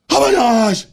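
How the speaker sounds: high, loud gushing shout from across the hall; high, shouted fan gushing; excited yelling. Retold or imitated. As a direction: yell it like a fan spotting a favourite brand. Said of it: "I start yelling this".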